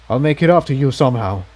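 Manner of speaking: ordinary delivery, nothing marked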